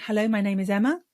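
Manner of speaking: "Hello, my name is Emma" rising sharply at the end like a question; upspeak. Shown as a demonstration. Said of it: "upspeak is when we make everything into a question".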